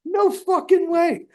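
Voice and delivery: very high, loud, slow stretched disbelief; retold disbelief. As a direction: drag it out in amazed denial. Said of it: "And I was like".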